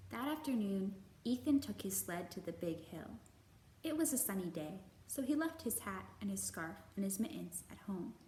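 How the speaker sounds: put-on gentle, measured read-aloud for children; storybook narration. A narrator's reading. Librarian reading a picture book to kids, gentle narration.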